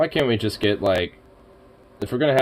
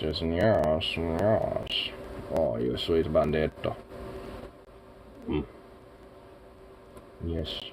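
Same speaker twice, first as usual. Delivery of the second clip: soft lower pet talk to Bandit; cooing at the cat; 3 semitones lower, 7 dB quieter than usual. Real, not acted. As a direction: coo tenderly to a fluffy cat.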